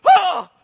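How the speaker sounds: sudden loud, high cry; imitated startled scream. Preacher imitating the man jumping off the ground, loud startled scream. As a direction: yelp as if hit by a jolt. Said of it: "he screams this out".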